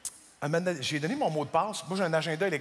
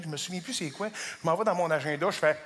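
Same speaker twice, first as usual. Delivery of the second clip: ordinary talk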